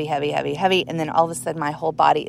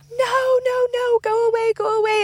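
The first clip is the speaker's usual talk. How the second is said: very high, frantic squeal; high-pitched, repeated cry of a frightened child; panicked refusal. Retold or imitated. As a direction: beg it to go away in terror. Reliving pushing the shadow away.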